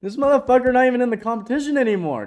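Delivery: loud, high, laughing disbelief; excited outburst. Real, not acted. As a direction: blurt it out in amazed glee. Laughing disbelief at friend's win.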